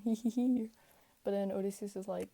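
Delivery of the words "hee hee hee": light hee hee giggle. Acted. Lotus eaters giggling while offering food.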